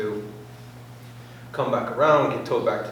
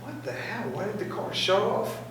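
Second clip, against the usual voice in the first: higher, softer baffled question; bewildered self-question, pitched up; puzzled; 9 semitones higher, 5 dB quieter than usual. Retold or imitated. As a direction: replay the moment of confusion. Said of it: "and I'm like".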